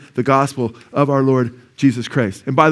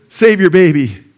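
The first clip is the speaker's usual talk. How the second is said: louder retold command; forceful urging; 3 semitones higher, 7 dB louder, slower than usual. Preacher relaying a woman's plea to a father, forceful urging. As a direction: drive it home as an urgent order.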